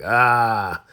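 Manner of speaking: a short, loud gasp of disgust. Retold or imitated. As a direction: gasp like you just got sprayed. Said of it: "We're like".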